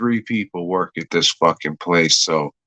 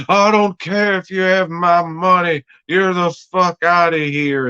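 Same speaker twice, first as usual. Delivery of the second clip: angry landlord; 8 semitones higher than usual. Retold or imitated. Landlord yelling at tenant.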